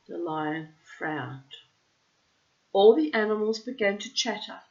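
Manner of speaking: animated read-aloud storytelling voice; storyteller reading to children; lively story narration. A narrator's reading. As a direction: tell it like a bedtime story with drama.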